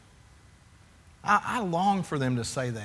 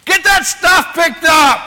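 much higher, much louder, slow yelled order; harsh shout; 13 semitones higher, 14 dB louder than usual. Retold or imitated. Dad yelling downstairs at the kids, furious shout. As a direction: blast it down the stairs at the kids. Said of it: "Why do you have to say it like that?".

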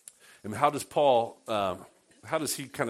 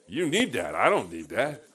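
mock proud voice; 4 dB louder than usual. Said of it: "he says".

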